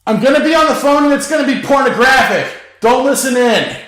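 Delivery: high, loud shouted warning; shouting down stairs. A narrator's reading. Danny warns roommates downstairs, loud high shout. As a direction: bellow it down to the whole house. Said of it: "Danny called down the stairs".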